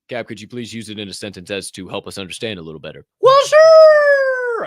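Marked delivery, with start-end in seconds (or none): 3.2-4.7 much higher, much louder cheery shout; eager shout. Acted. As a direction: burst out with big eager glee. Answering Cap's request, eager.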